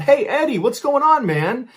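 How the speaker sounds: high, loud call down the hall; hearty yelled greeting. A narrator's reading. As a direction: holler to a buddy from afar. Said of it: "someone down the hall behind him yells out".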